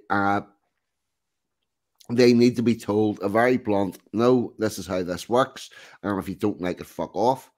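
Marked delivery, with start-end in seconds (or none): none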